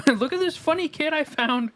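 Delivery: amused high falsetto; girl voice. Acted. Game girl showing off a kid, high falsetto voice. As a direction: giggle into a high girl character voice. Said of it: "My girl voice is the exact same".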